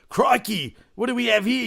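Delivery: high-pitched, exaggerated Australian exclamation; proper Aussie. Australian mocking what locals never say, broad Aussie. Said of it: "They talk like proper, proper Aussie".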